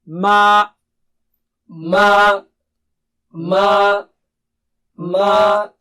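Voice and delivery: ma from the mouth, not the nose; loud drill of ma, no nasal; ma repeated clearly from the mouth; non-nasal ma. Shown as a demonstration. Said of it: "repeat after me three times".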